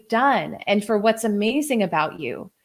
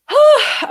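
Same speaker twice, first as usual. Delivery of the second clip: loud high sigh; 8 dB louder than usual. Real, not acted. Reset after emotional comments.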